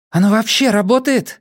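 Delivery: high, anxious question; impatient question. A narrator's reading. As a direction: ask doubtfully, pitch well up. Anxious question during slow drilling.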